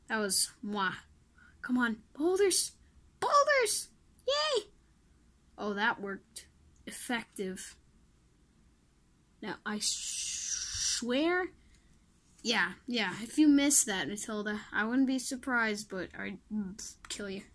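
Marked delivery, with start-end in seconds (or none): 1.6-4.6 squeaky high cheering; high excited squeal of joy. Real, not acted. Cheering on the boulders. 15.9-17.4 soft unvoiced whispered threat; whispered joking threat; mock threat.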